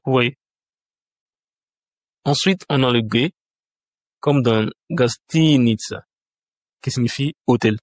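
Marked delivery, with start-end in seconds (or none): 4.9-6.0 Gastinitsa with a long "TI": gas-TEE-nitsa; stress on second syllable. Shown as a demonstration. Teacher gives the example for G, stress on second syllable. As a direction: stretch the first i of Gastinitsa. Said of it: "l'accent tonique est mis sur".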